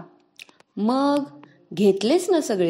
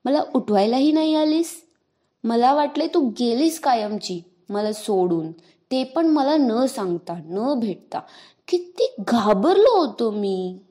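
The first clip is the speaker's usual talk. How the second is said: put-on a young boy's hurt, pleading complaint, pitched higher; sulky child who was scared he'd been left; upset little boy; 4 semitones higher, 4 dB louder than usual. Little brother reproaching his sister, hurt little boy voice.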